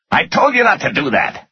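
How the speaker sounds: high, exasperated angry reproach; yelled scolding. A narrator's reading. Finance guy reproaching his boss, yelling. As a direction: pitch it way up, scolding in anger. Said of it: "the finance guy yelled".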